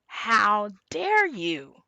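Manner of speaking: slow, drawn-out mock outrage; hammed-up indignant exclamation; strongly felt indignation. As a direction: imitate someone deeply affronted, stretching each word. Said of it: "they are both feeling strongly".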